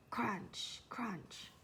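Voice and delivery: breathy whispered crunch. A narrator's reading. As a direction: whisper the sound effect. Footsteps in snow.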